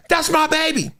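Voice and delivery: a touch of mom cheering from the stands; proud mama cheer. Retold or imitated. Mom in the stands cheering her son, lightly voiced. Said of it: "she yelled only for good stuff".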